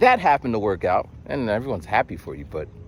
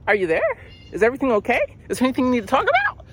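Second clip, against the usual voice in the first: high caseworker voice, speeding up at the end; Chloe; 12 semitones higher, much faster than usual. Retold or imitated. Caseworker checking whether Matt is okay, high imitation. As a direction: pepper him with high-pitched check-in questions. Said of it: "And she's just like".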